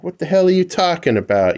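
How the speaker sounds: incredulous. Retold or imitated. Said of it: "I'm like".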